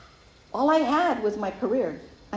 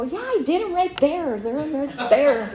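sarcastic; 6 semitones higher, slower than usual. Retold or imitated. Sarcastic reply to her sponsor.

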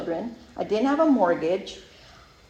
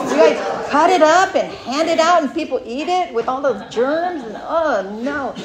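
disgusted; 6 semitones higher, 7 dB louder than usual. Retold or imitated. Disgusted rant about the cake.

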